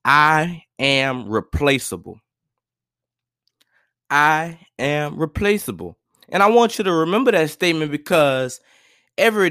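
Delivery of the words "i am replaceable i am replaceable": slow, deliberate mantra, spaced out; measured call-and-repeat affirmation; recitation to repeat after. Shown as a demonstration. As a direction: say it slowly for them to repeat. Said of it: "Recite this after me".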